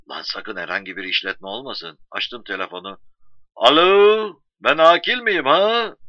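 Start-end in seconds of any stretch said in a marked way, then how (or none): 3.6-6.0 loud, high, stretched-out hello and question; excited phone call. Acted. New wise man phoning to ask if it's him, excited. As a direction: call eagerly down a phone line.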